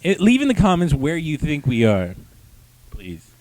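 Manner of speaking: normal talking voice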